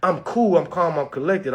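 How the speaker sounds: normal talking voice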